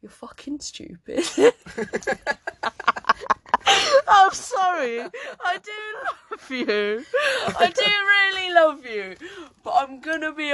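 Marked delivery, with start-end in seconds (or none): 1.4-7.0 high laughing fit; laughing hard. Real, not acted. Laughing fit after insult. 7.6-9.2 high laughing shout; laugh-shout the declaration. Real, not acted.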